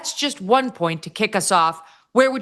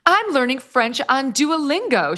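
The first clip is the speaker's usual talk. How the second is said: hammy dramatic reading; 7 semitones higher, slower than usual. Retold or imitated. Mocking Meghan quote in article.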